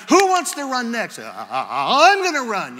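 high, loud eager call and stammered answer; mock over-excitement. Church runners egging each other on, hammed-up excitement. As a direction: act out worked-up volunteers, voice jumping about.

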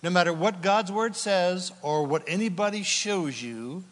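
ordinary delivery

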